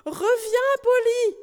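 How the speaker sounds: very high, loud calling out; desperate shout. Acted. Knight calling out of the tower window to the parrot, shouting. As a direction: call after her with all his strength. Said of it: "cria-t-il de toutes ses forces".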